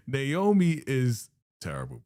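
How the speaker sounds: normal talking voice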